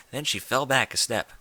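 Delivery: usual speaking voice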